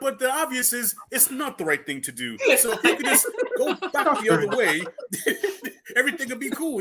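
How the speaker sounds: high laughing polite voice; amused mock-gentle Mike; laughing gentle imitation. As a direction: giggle through a kind let-down. Imitating Mike breaking bad news.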